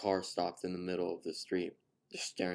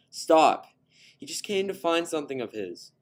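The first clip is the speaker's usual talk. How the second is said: higher and much louder desperate cry; shouting; 10 semitones higher, 12 dB louder than usual. A narrator's reading. Daughter shouting at her father to stop, desperate yell. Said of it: "Caitlin shouted".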